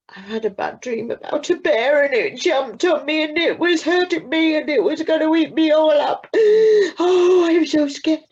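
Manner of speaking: put-on high, loud, panicky child's voice; a boy crying out after a nightmare; terrified child. A narrator's reading.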